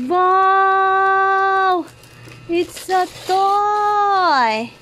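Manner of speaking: high, long-held wows; slow, high baby-talk amazement; playful awe. Pretend. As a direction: draw it out in pretend wonder for a baby.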